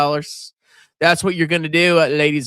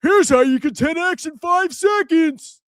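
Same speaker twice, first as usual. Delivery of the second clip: mock clickbait hype; 11 semitones higher than usual. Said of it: "title the video".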